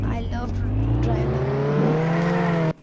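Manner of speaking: drawn out slow. Real, not acted. Gamer to viewers about the Lambo, slow and dreamy. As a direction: stretch out Lambo slowly.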